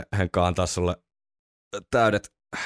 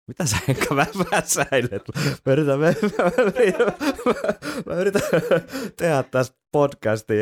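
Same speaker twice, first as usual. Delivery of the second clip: laughing; 10 semitones higher than usual. Real, not acted. Host laughing while trying to talk.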